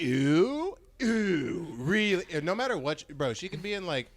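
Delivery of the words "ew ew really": louder mock disgusted ew; disgusted sneer. Rejected guy mocking the girl, disgusted sneer. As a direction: sneer at her like she's gross. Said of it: "I'd be like".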